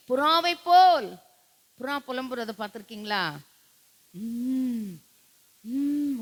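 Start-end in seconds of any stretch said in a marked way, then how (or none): 0.1-1.1 high, loud mourning cry; anguished cry. Acted. Pastor acts the king crying out on his deathbed, anguished. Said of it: "எங்க கதறினாராம்?". 4.1-6.2 dove moan. Retold or imitated. Pastor imitates a dove's mournful moan. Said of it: "அப்படிங்கும்".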